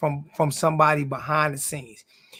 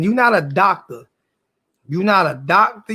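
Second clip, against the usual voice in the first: ordinary talk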